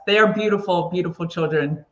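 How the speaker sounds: ordinary talk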